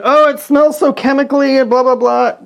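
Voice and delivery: high, loud mocking whine; mocking whiny voice. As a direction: poke fun at the complainers. Mocking others' complaints about smell.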